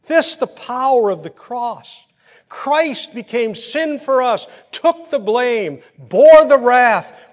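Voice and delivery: slow, high and loud, building to the last line; slow thundering sermon declaration; preaching at full force. Acted. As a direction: proclaim it like a pulpit climax. Preaching climax on the cross.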